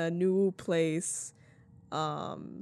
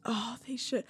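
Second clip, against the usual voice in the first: whispered; 3 semitones higher, 6 dB quieter than usual. Real, not acted. Hushed agreement.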